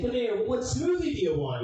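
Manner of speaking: high, eager sales voice; cheery salesman. Retold or imitated. Presenter coaxing the weakest kid, sugary high voice. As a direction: coax the kid in.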